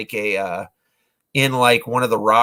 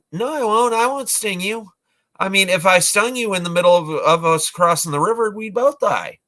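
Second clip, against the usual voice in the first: higher, lightly voiced scorpion; scorpion pleading; 6 semitones higher than usual. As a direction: plead innocence in a raised voice. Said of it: "the scorpion's like".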